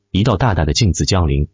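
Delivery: ordinary delivery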